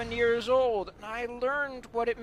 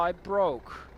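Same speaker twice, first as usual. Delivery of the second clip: usual speaking voice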